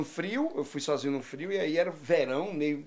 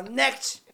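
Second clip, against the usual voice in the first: raised, higher NEXT, imitating the clerk; clerk's rising intonation; 8 semitones higher than usual. Clerk calls the next customer in the store, NEXT pitched up. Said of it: "ela aumenta e você muda".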